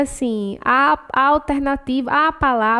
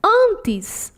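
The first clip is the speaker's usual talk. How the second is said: normal talking voice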